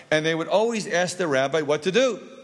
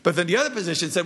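usual speaking voice

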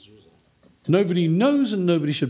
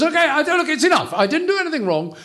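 much higher, exasperated outburst; losing it; 14 semitones higher, 3 dB louder, faster than usual. Retold or imitated. Job at the end of his rope with friends, exasperated high outburst. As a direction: snap at the friends, fed up. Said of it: "Job loses it".

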